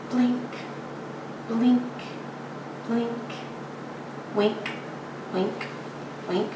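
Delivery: tiny, quiet words with long pauses between; soft isolated words. A narrator's reading. Poet mimics the muse's blinking, soft and spaced out. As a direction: drop each word softly into silence, one by one.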